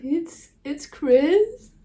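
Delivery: high tearful voice breaking into laughs; overwhelmed, crying with little laughs; real crying. Real, not acted. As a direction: cry and laugh at once, pitched high. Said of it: "I'm really crying".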